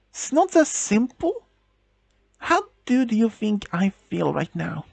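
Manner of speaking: high, incredulous echo; high indignant "It's not that simple?". Acted. Girlfriend throws his words back.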